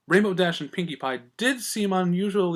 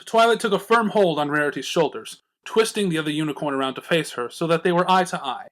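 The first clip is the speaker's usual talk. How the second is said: ordinary delivery, nothing marked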